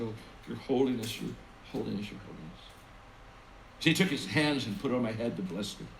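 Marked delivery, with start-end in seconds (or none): 0.0-2.6 reverent awe. Retold or imitated. Kneeling reverently before the Pope.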